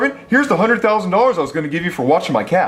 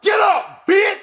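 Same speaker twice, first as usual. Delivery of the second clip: bratty yell; 11 semitones higher, slower than usual. Acted. Kid yells at dad after hitting.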